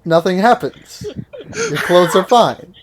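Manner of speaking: giggly loud narration; trying not to crack up; laughing. Real, not acted.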